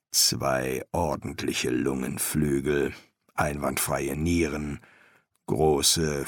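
low, soft, measured master; quietly appreciative master embalmer; master embalmer, appraising. A narrator's reading. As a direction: weigh it up in a low professional voice.

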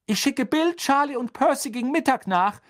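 high, loud motherly announcement. Acted. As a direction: tell your husband in a high, carrying voice.

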